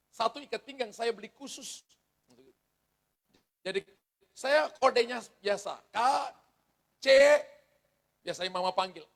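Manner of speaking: normal talking voice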